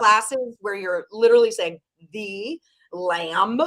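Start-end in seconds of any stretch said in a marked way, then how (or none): 2.1-3.6 crisp announcer delivery of "The Lamb"; broadcaster diction. Shown as a demonstration. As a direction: say it like a trained sportscaster. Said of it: "learning how to say things properly".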